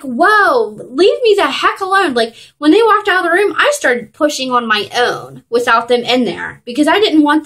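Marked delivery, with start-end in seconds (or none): 0.0-2.1 higher, louder retold outburst; exasperated protest. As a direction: push them away, mock exasperation. Acted protest at pushy staff.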